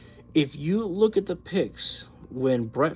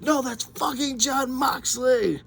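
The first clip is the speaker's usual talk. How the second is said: high, thrilled retort; excited correction; 12 semitones higher than usual. Retold or imitated. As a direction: fire it back, buzzing with excitement. Retelling correcting cousin, excited.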